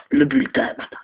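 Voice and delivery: whispered reveal of le bulletin; dramatic hushed reveal. Acted. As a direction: breathe le bulletin as a secret. Reveals the report card.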